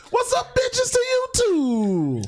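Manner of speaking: high echo, then slow; mocking echo. Player echoing the tiny servant in a high voice. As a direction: toss the greeting back, grinning.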